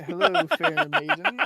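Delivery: high, loud, laughing greeting to a maiden; awkward gallant greeting. Acted. Boy greets the cook at her cauldron, high and loud, laughing. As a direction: play the awkward knight, voice cracking with a laugh. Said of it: "Yes, and I say".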